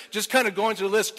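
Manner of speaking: normal talking voice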